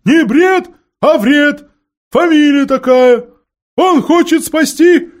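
high, loud owl shout; owl shouting correction. A narrator's reading. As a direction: yell the correction at the deaf dwarf.

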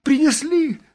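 very high, loud falsetto; high-pitched excited woman; eager, frivolous question. A narrator's reading. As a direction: ask eagerly, as if about a new dress. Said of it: "сказала Брике таким тоном, как будто вопрос шёл о новом платье".

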